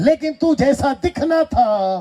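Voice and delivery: high, slow preacher's reproach; full-force preaching. Acted. Preacher voices God's reproach to the congregation, high drawn-out preaching. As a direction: stretch it out in a high voice.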